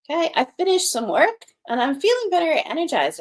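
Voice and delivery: bright, lifted energized voice. Shown as a demonstration. Teacher acting out feeling energized for pupils. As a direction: sound charged up and full of energy. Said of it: "I'm charged up, I'm energized".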